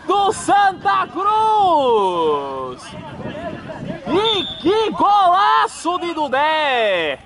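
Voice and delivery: long drawn-out goal shout; explosive goal commentary. Real, not acted. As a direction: roar the goal call, stretching it out. Goal scored, commentator erupts.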